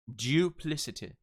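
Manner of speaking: DUPLICITY with 'dyoo' at the start; fluid J. Shown as a demonstration. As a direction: show the Y glide in DUPLICITY. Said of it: "use the fluid J".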